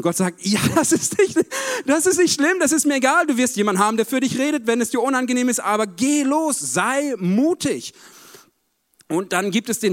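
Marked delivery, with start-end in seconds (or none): 0.4-4.7 high, laughing and louder God voice; amused God. Retold or imitated. God laughing off Moses' stammer, high and light. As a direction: brush off the worry with a laugh.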